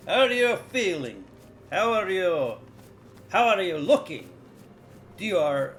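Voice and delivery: loud, high-swooping Russian comrade voice; put-on Russian accent. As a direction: greet them in a big, hearty Russian accent. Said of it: "that whole fucking accent".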